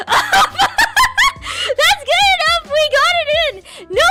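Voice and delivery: loud, laughing relief. Real, not acted. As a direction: laugh it off loudly. Laughs after finishing intro.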